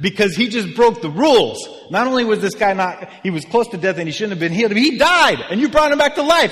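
high, loud, building to a yell; hammed-up panicking townsperson, voice climbing; frantic mock panic. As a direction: escalate into a hysterical rant. Frantic townspeople fearing angry gods.

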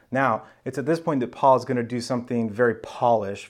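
usual speaking voice

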